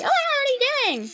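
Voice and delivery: very high-pitched, imitated shock; mock alarm. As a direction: act out her alarm in a squeaky-high voice. Voicing girl waking during assault.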